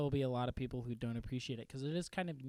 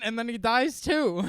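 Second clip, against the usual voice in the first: amused punchline; 8 semitones higher, 10 dB louder than usual. Real, not acted. Punchline of the story.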